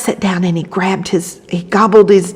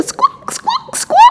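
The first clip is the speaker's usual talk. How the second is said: hen squawk; 5 dB quieter, slower than usual. Acted.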